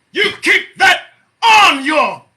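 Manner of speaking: loud, high, spaced-out words; commanding shout. Real, not acted. Host signing off with an order to listeners, shouting. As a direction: punch every word as a parting order.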